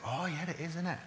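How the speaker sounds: quiet retold reply to a nudge during a film; hushed reply in the cinema. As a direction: lean over and answer under your breath. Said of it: "we were nudging each other".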